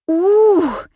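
loud high "ooh"; startled squeal. A narrator's reading. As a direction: squeal in sudden fright. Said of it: "взвизгнула Женя". (испуганный визг)

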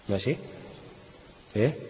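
ordinary talk, nothing marked

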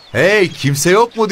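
high, loud, slow call across the boatyard; shouting out. Acted. Officer calls out at the empty boatyard, loud high shout. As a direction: call out loudly to anyone around. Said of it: "diye bağırdı".

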